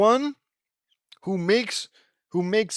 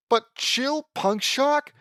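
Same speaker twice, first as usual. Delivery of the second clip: ordinary talk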